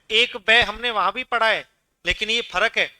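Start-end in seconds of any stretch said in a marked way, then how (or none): none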